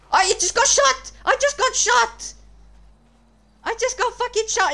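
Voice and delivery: high outraged yell; shouting. Real, not acted. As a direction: yell it out in disbelief. Yelling after being shot in game.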